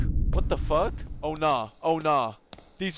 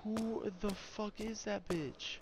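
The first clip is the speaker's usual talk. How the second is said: hushed; 4 semitones higher, 13 dB quieter, slower than usual. Real, not acted. Creeped out by figure.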